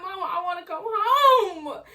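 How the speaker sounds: high, crying, half-laughing wail; crying plea. Retold or imitated. Homesick teen crying to her mama on the phone, begging to come home. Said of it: "I'm sitting here crying to my mama".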